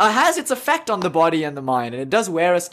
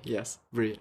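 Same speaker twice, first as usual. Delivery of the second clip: much softer, lower murmured repeat of Brie; 8 semitones lower, 12 dB quieter than usual. Real, not acted. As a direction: drop it to a hush. Answering a student twice.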